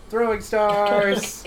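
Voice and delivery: gleeful announcement. Real, not acted. Gleeful repeat card play.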